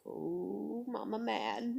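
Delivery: soft, amused mock warning; under the breath, half laughing; child's hushed reaction. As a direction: imitate a kid tiptoeing round mom. Voices child's reaction to mom.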